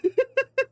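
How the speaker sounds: high embarrassed laugh. Real, not acted. Laughs at own impression attempt.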